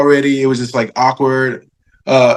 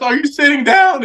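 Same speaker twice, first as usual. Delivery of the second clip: dramatic question; 12 semitones higher than usual. Retold or imitated. Re-acting the call again.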